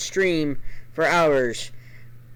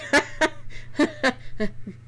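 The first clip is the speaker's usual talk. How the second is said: mocking laugh; 9 semitones higher, 4 dB quieter than usual. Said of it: "I would do that as Charwolf".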